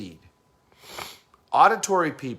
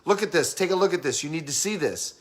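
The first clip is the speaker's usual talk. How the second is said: quick, higher, urgent insistence; stressed visual person; 4 semitones higher than usual. Acted. Visual person in a stressful situation, hurried and insistent. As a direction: push it out fast, urging them to look. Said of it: "visual people are gonna be moving, moving".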